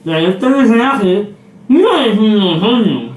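garbled through a full mouth; marbles in mouth. Real, not acted. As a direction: mouth stuffed, words slurred. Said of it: "no se te entiende nada".

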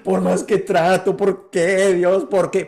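high, loud wailing plea to God; a man sobbing in a sea of tears, begging God why; acted despair. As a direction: cry out to God in despair, voice breaking with sobs. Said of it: "en un mar de llanto".

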